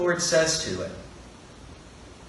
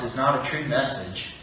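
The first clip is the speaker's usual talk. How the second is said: ordinary talk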